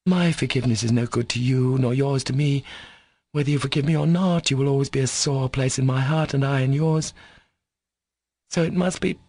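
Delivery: heartfelt, rising woman's voice; passionate Katya. Acted. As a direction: speak from the wound.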